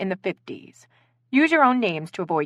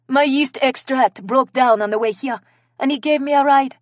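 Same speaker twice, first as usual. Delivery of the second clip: put-on high, louder French-accented reply; French accent, haughty; 7 semitones higher, 5 dB louder than usual. A narrator's reading. Questioned guest explains with head held high, French accent. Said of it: "with a French accent".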